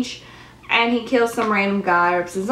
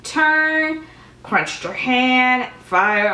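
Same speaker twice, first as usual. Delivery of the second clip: mock incantation; witch's spell; 3 semitones higher, much slower than usual. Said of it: "And she was like".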